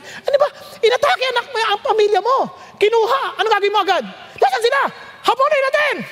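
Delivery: high-pitched, agitated rallying cry; acted urgent alarm. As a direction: play someone roused to give chase at once. Acting out panicked reaction to attack.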